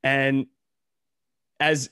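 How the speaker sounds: ordinary delivery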